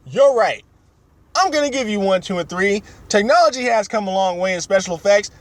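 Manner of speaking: lightly voiced, high giving-in creator; mock George Lucas. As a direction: give in with a grand announcement. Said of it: "It's like".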